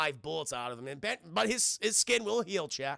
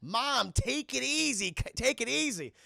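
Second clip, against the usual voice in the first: high, whining protest; mock tearful plea; 6 semitones higher, slower than usual. John crying as his mom berates him, whiny pleading. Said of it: "And he's crying".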